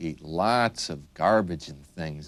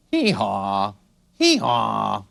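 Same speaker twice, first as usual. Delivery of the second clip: donkey bray; 2 semitones higher, 5 dB louder, slower than usual. Shown as a demonstration. Teacher showing how a donkey goes, braying hee-haw. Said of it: "A donkey goes".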